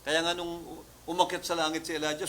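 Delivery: usual speaking voice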